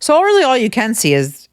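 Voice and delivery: ordinary delivery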